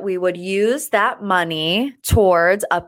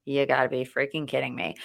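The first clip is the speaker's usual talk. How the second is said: low, soft, disbelieving grumble; exasperated mutter; 6 semitones lower, 8 dB quieter, faster than usual. Real, not acted. Reacting to fifteen-hour flight.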